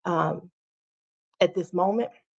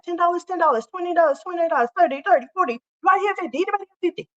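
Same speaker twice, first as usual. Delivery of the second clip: fast, high auctioneer chant; 7 semitones higher, faster than usual. Shown as a demonstration. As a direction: rattle off bids like an auction. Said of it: "I start talking like an auctioneer".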